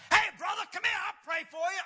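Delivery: high, loud preacher's holler; imitated yell. Pastor calling a reluctant man up for prayer, yelling. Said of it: "he yelled out".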